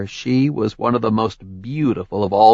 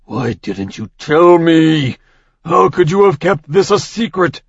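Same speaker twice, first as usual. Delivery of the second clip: furious demand; 7 semitones higher, 5 dB louder than usual. Acted. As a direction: demand it in rage. Zeus furious at wife.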